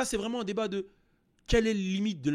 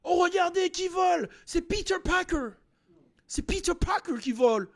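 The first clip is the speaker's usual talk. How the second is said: high, slow, exaggerated French-Canadian film voice; mock a Canadian dub with wide-eyed wonder; hammed-up Québécois dubbing; 13 semitones higher, 4 dB louder than usual. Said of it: "Ils parlent normal".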